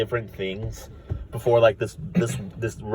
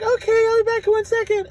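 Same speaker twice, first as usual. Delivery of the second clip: very high sing-song clerk voice; chirpy teller; 3 dB louder than usual. Retold or imitated. Teller lady excusing herself, very high and chirpy. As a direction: squeak a cheerful brb. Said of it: "the girl's like".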